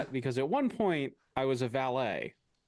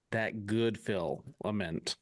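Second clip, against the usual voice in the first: slow savouring; 4 semitones lower, much slower than usual. Acted. Savouring repeat.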